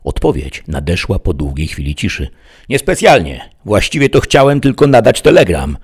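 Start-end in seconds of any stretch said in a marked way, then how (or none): 2.7-5.8 high, loud reply; calling back. A narrator's reading. Bandit answers the sheriff from inside, calling out. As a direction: answer loudly to someone outside.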